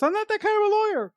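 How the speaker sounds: very high, rushed imitated plea; hammed-up high-pitched objection; mock panicked protest. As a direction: squeal it fast in playful protest. Acts out Peter's panicked protest.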